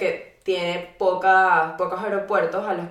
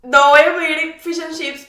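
mock firm refusal; 6 semitones higher, 6 dB louder than usual. Retold decision at restaurant.

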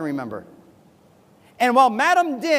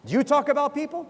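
normal talking voice

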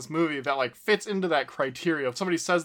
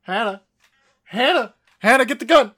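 frantic shout for Hannah, higher and louder; panicked yelling; 6 semitones higher, 9 dB louder than usual. Retold or imitated. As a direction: yell in panic, louder each time. Panicked man calls for gun.

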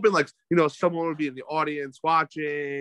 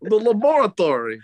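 slow, louder mock Dexter accent; Dexter's Lab accent; 7 semitones higher, 8 dB louder, slower than usual. Friend imitating Dexter from Dexter's Lab for laughs. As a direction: draw out the word like the cartoon boy genius. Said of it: "she talks like Dexter from Dexter's Lab".

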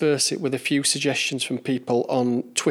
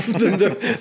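laughing; 5 semitones higher than usual. Real, not acted. Laughs mid-sentence.

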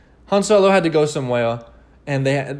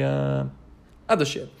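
ordinary delivery

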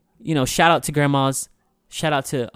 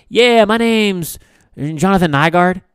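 high, loud, slow put-on caller voice; a hammed-up caller giving his name; 7 semitones higher, 4 dB louder, slower than usual. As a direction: stretch it out like the odd caller. Caller voiced in phone story.

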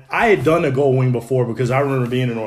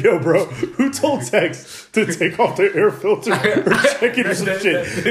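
laughing; 7 semitones higher, slower than usual. Retold or imitated. Retold coworker question, laughing.

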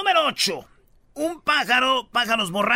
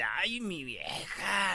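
very slow and softer, crooning at his wife; a light imitation of a husband teasing his wife; lightly voiced husband teasing his wife during yoga; Tommy Motola; 8 dB quieter, much slower than usual. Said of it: "Llega Motola y le dice".

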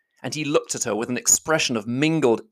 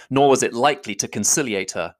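usual speaking voice

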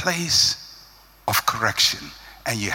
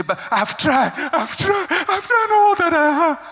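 much higher, voice breaking into a cry; tearful pleading; 12 semitones higher, 3 dB louder than usual. Retold or imitated. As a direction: beg to be understood, close to sobbing. Frustrated follower pleading near tears.

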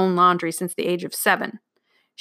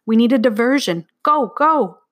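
raised, louder, pressing command; urgent urging; 4 semitones higher, 5 dB louder than usual. A narrator's reading. Girl sends the boys off as a diversion, urgently. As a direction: push them out the door, higher and louder.

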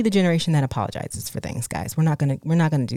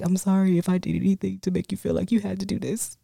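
teary dad apology; 3 semitones higher, 4 dB quieter than usual. Retold or imitated. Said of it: "my dad would start crying and he'd apologize".

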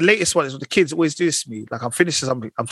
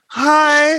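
much higher, louder, strained whine of a hello; reluctant forced greeting; 12 semitones higher, 6 dB louder than usual. Retold or imitated. Sibling forced to greet an auntie, strained high whine. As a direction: squeeze out a pained hi.

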